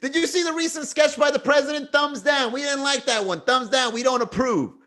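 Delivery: a high, prim voice giving the thumbs down; high mock senator. A pretend senator voting down the president's sketch, prim and high. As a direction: play a fussy senator rejecting the sketch.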